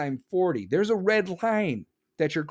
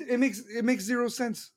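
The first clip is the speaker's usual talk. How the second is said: ordinary delivery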